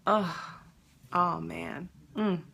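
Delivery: ordinary delivery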